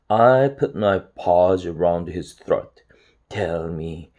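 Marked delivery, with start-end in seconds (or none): 3.3-4.1 low, soft menace; low threatening voice. A narrator's reading. Harold, paws round Chester's throat, low and threatening. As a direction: threaten under your breath. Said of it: "in a low, threatening voice".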